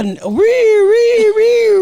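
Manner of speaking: high, loud mock siren wail; siren imitation. Mother mimics the approaching ambulance siren, high wail.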